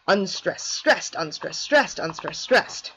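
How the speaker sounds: "stressed" hit, "unstressed" falling away, counted slowly; rhythm spelled out. Shown as a demonstration. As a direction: beat out the pattern, leaning on each "stressed". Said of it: "stressed and unstressed syllables".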